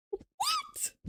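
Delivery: very high, soft squeak of disbelief; put-on reactor's astonishment; exaggerated shocked reaction. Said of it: "I have to be like".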